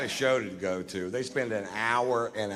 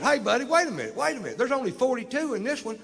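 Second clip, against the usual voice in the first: high mock cop; 13 semitones higher, faster than usual. The cop counting cash, cries out in high alarm. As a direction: squeal it like a panicked cop. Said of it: "he goes".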